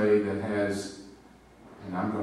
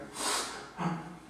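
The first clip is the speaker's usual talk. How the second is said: higher breathless gasp of emotion; touched gasp; 9 semitones higher, 5 dB quieter than usual. Retold or imitated. Teacher moved by student's words on campus, gasping. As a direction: catch your breath, moved. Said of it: "here I am in the middle of school campus going".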